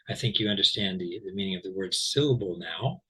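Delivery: normal talking voice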